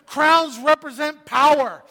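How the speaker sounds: high, emphatic sermon delivery; fervent preaching. Real, not acted. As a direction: preach it with rising conviction. Preaching to congregation.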